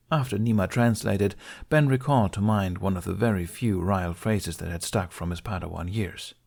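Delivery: plain reading voice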